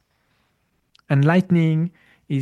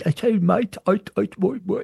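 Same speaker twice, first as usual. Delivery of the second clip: imitated Cockney, a bit higher and stammering; stuttering Cockney accent; 4 semitones higher than usual. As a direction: mimic a Cockney Londoner who stutters. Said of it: "Cockney accent of London with stuttering".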